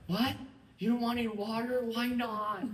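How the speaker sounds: high, loud mock disbelief; hammed-up waiter outraged you refuse water; mock shocked waiter. Waiter to diner, hammed-up high disbelief at a refusal. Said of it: "they're like".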